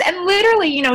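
usual speaking voice

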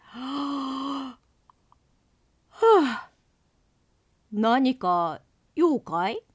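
big yawn through the words. Acted. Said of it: "大あくびをし".

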